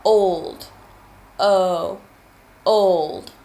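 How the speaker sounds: put-on Old with a loud, drawn-out O; stressing the O. Shown as a demonstration. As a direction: lean hard on the O in Old. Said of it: "Do you hear the O?".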